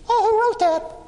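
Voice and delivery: high, meek voice of a quiet committee member; timid little man. Retold or imitated. Quiet man in the committee asks timidly, high and meek. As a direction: pipe up like the shy little guy. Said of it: "a little quiet guy".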